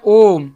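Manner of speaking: "ओम" with a long ringing "ओ"; resonant drawn-out vowel. Shown as a demonstration. Teacher showing learners the sound resonating, long "ओम". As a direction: hold "ओम" so the sound echoes on. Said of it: "यानी आवाजवा गूंज रहा है".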